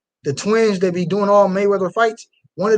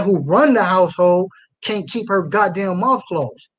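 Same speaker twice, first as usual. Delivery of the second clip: ordinary delivery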